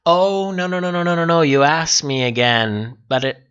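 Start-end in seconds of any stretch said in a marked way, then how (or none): none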